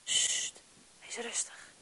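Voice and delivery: whispered, soft hush from God; God whispering calm to the hurt; gentle hush. Acted. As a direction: breathe it like soothing a frightened child. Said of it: "hij zegt tegen ons".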